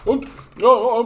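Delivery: high-pitched cartoonish hooting; Bubbles. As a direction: hoot high in a goofy character voice. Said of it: "so literally bubbles".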